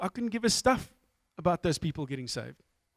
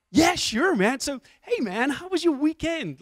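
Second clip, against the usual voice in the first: high-pitched, over-friendly American dude; excited bank teller; 9 semitones higher, slower than usual. Retold or imitated. Teller greeting customer he recognizes, mock excited. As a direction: imitate an eager, chummy teller. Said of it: "he's so excited to see me".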